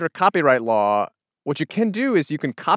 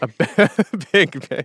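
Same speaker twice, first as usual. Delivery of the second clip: cracking up mid-word; laughing too hard to finish; slower than usual. Real, not acted. Laughing through joke.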